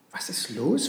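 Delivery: mildly surprised, rising at the end; understated puzzlement; puzzled question. A narrator's reading. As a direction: ask with a touch of wonder. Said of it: "fragte Josef verwundert".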